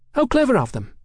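high-voiced cheeky London girl. A narrator's reading. Hetty remarks.

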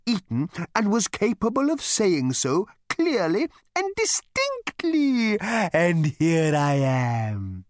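high, slow, courteous cow; polite cow voice. A narrator's reading. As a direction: offer yourself like a cow waiter. The animal speaks.

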